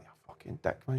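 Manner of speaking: breathy, nearly voiceless mock insult; wife's muttered insult. Wife muttering at husband over his perfect day, voiceless mock insult. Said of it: "she's sitting like that".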